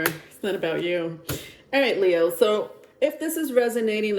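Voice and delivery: ordinary talk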